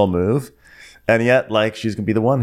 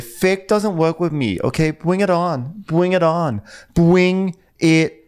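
higher, slow put-on challenge; mock defiance, drawing out the words; Diana; 5 semitones higher, slower than usual. Host voicing Diana daring Sutton, slow mock defiance. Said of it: "she's like".

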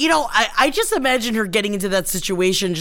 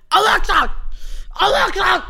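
yelling at Alexa; 11 semitones higher than usual. Retold or imitated. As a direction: yell the name at the device. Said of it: "Just screaming at it like that".

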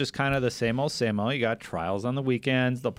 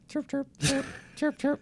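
parakeet chatter. Retold or imitated. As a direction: chirp like a little bird, laughing. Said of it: "your onomatopoeia version".

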